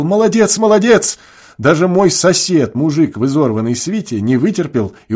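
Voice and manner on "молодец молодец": high, rising cheer; excited shout. A narrator's reading. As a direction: yell praise like a madman. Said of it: "закричал".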